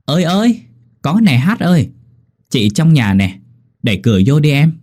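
high answering call, easing off; calling back. A narrator's reading. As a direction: holler back from inside the house. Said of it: "có tiếng đáp vọng ra". (đáp vọng ra từ trong nhà)

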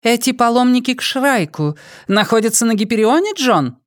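high, cool woman's voice; Dayana's voice. Acted. Dayana questioning the drugged prisoner, high and cold. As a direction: play the cold interrogator lady.

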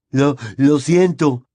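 halting, stammered apology, slow; faltering. A narrator's reading. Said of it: "dijo entrecortadamente".

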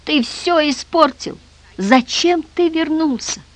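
high, slow wail of despair; anguished, high-pitched reproach. A narrator's reading. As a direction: lament it high and drawn out. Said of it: "в отчаянии повторял Мирон Антиохович".

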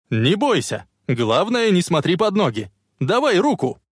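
urgent encouragement. A narrator's reading. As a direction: call out to steady and urge. Urging frightened woman to reach out. (настойчивый ободряющий крик)

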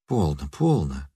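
soft, low calming murmur; calm her down gently; gentler soothing. A narrator's reading. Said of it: "уже мягче продолжал он".

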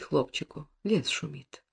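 fading to a whisper; whispered lullaby. A narrator's reading. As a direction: let the lullaby die into a whisper. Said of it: "баюкает".

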